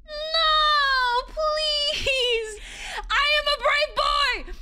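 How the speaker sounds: high, drawn-out whine; frightened pleading. Real, not acted. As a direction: wail bravely through the fear. Pleading, too scared to go on.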